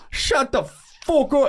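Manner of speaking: high, drawn-out outburst of an African traveler; imitating a man losing it; African spaz. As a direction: spaz out in a high, stretched yell. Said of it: "he does this, like, African spaz".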